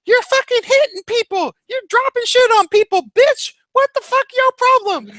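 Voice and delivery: very high, screechy imitated rant; screaming. Crackhead yelling at the person in the window, screaming. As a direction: scream up at someone in hammed-up outrage. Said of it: "started screaming at the person in the window".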